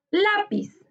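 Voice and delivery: Lápiz with LÁ pitched high, then falling; stress on LA. Shown as a demonstration. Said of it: "Su sílaba tónica está en la". (Lápiz con acento marcado en LÁ)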